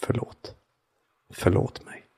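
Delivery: "Förlåt" breathed as a soft whisper, not a shout; a quiet whisper standing in for a remembered call; hushed, tender "Förlåt"; whispered apology. Retold or imitated. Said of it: "Ropat rakt ut i mörkret".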